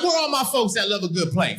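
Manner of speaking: ordinary talk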